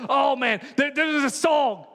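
a churchgoer gushing about Sunday, lightly hammed-up; excited churchgoer. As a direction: rave about the service like a thrilled fan. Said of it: "you go".

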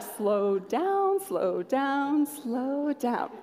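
drawn-out, hushed "slow down" chant; mock-soothing, long slow vowels; slow urging. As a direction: stretch each word like slow motion. Imagined listener urging her to slow.

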